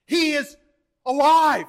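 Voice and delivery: high, loud pulpit proclamation; exultant full-force preaching cry; triumphant shout. Real, not acted. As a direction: shout the good news, hitting "alive". Climax of the resurrection proclamation.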